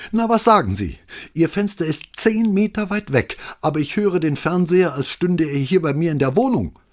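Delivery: high, pushed, indignant voice; irate neighbour. A narrator's reading. Neighbour phoning the police about the loud TV, indignant. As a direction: press the complaint, barely holding it. Angry neighbour complains on phone.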